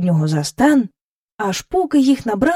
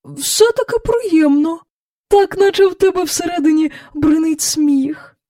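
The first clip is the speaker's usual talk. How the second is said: searching for words; 8 semitones higher than usual. A narrator's reading. Cow explaining how dancing feels, choosing words. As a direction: feel for each word in a high voice. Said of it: "ніби добираючи слово".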